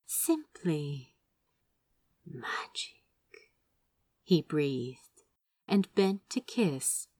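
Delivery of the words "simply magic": breath dropping into a whisper on magic; awed whisper. A narrator's reading. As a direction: whisper simply magic in wonder.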